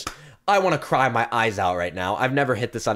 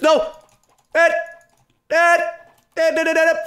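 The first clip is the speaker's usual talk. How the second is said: high, loud rapid-fire yelps; high, explosive gamer outburst; frantic shouting; 6 dB louder than usual. Real, not acted. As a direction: yell each word like a blow. Calling kills in the game.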